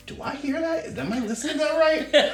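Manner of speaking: high, amused double-take; laughing disbelief; mock disbelief. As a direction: pitch it way up like you can't believe your ears. Imagining hearing modern songs in period show.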